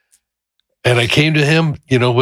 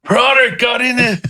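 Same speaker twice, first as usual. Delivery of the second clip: softening yell; 13 semitones higher than usual. Retold or imitated. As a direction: still high but easing off. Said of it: "it started to soften".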